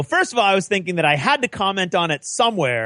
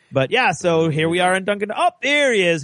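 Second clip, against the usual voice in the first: normal talking voice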